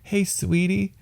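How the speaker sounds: lightly voiced doting wife; imitated sweet wife. Husband imitating his wife greeting him, lightly voiced sweet talk. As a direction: play an affectionate wife, hammed up a little. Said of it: "That's how she talks".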